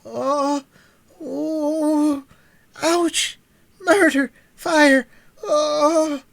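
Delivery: frantic high shouts of pain; crying out in pain. A narrator's reading. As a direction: howl for help in agony. Boy in fearful pain.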